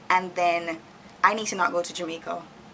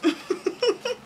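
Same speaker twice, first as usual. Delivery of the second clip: short rising giggle; high giggle. Real, not acted. Giggling at the stale cookie, high and rising.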